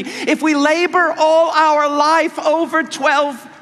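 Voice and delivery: fervent pulpit crescendo; preaching at full force. Acted.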